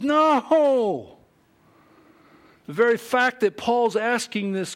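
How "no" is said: high, loud drawn-out "No!"; booming, outraged refusal; emphatic refusal. Acted. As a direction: cry out an absolute no. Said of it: "That's what he means".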